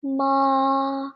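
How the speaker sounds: MA held high and level; one long MA kept high without moving; high flat tone. Shown as a demonstration. Teacher showing pitch five, ma high and flat. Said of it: "So it is high and flat".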